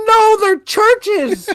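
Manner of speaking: very high, loud, drawn-out squeal; exasperated shout. Retold or imitated. Retelling his last retort to the coworker, exasperated shout. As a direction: yell it slowly in comic despair.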